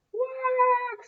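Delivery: high honking dinosaur cry; dinosaur call. Acted. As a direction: honk like a gentle dinosaur. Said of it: "said George".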